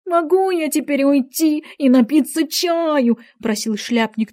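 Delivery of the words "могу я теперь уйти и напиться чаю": anxious. A narrator's reading. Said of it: "тревожно глядя на королеву".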